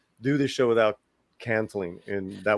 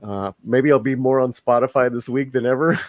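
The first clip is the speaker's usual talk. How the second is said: usual speaking voice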